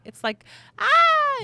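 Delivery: very high, loud drawn-out ah; imitated baby squeal. Mother imitating her baby's excited squeal, high. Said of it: "kinda like a scream, but it's not".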